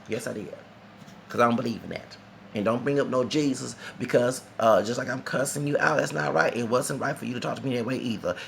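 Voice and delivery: normal talking voice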